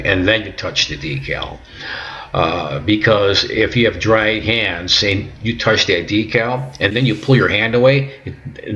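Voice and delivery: ordinary talk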